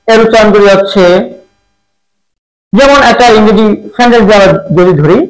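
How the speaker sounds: usual speaking voice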